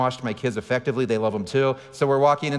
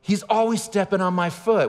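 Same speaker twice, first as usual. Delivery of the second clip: higher whiny mock yell; daughter yelling; 8 semitones higher than usual. Daughter complaining about her brother, higher mock yell. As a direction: complain up high like a wronged kid. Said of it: "she yells".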